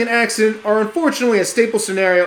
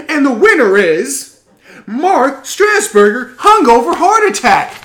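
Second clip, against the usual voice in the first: rising louder into the winner; suspenseful awards presenter; 4 semitones higher, 5 dB louder than usual. Acted. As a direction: build suspense then announce.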